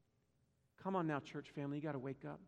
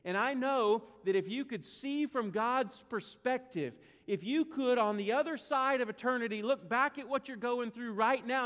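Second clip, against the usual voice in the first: preaching at full force; 5 semitones higher, 6 dB louder than usual. Real, not acted. Preacher urging the church family to trust God, impassioned. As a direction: lift the church with a swelling preacher's cry.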